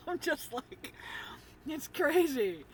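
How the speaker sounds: high laughing outburst. Real, not acted. Laughing at own overload.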